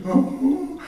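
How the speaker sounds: put-on high whimpering little-boy ooh; imitated child's sob. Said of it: "he's sobbing louder".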